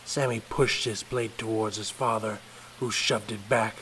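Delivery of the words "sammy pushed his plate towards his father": usual speaking voice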